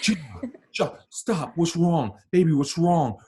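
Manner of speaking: high, panicked mother's voice; worried mom calling for help, pitched high; mom freaking out. Retold or imitated. Mom hearing her son sob on the phone, panicked high. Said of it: "She starts freaking out".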